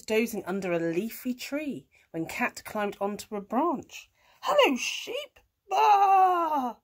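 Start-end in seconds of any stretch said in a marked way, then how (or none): 4.4-5.4 high, loud, bright-pitched cat hello; chirpy cat greeting. A narrator's reading. Cat greeting horse in the field, high cat voice. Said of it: "said Kat". 5.7-6.8 loud, high, drawn-out baa; sheep bleat. A narrator's reading. Storyteller making the sheep's noise, loud long baa.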